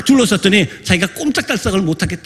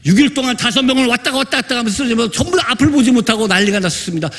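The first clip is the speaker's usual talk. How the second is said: ordinary talk, nothing marked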